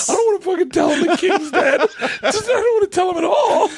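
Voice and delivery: high, laughing panicked messenger; a messenger refusing to tell the king, laughing high. Pretend. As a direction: beg off the job while cracking up. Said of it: "he's like".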